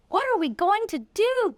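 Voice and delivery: high, pleading cry of worry; distressed cry. A narrator's reading. Mother cries out as her daughter melts into walls, high and pleading. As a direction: cry out in helpless panic. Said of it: "cried Miss Cream".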